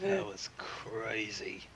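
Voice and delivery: soft stunned whisper. Real, not acted. Host stunned after a wild story, whispering. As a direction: breathe it out in disbelief.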